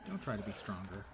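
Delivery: ordinary talk, nothing marked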